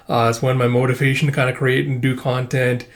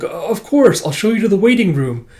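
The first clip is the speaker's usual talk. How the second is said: polite organizer; 7 semitones higher, 3 dB louder than usual. Acted. Organizer answering the chairman.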